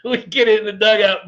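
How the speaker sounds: laughing. Real, not acted. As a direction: repeat the line while still cracking up. Laughing retelling.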